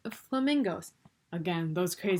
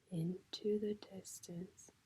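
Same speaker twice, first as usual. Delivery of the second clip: whisper; 11 dB quieter, slower than usual. A narrator's reading. Voice fading away.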